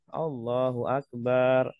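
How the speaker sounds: Akbar with the ba drawn long, slowly; wrong long ba. Shown as a demonstration. Teacher showing the wrong takbir with a long ba. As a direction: stretch the ba in Akbar. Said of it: "Kalau bacanya akbar".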